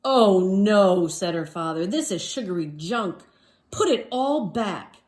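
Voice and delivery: father scolding about sugary junk; stern father. Acted. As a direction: lay down the law to a kid.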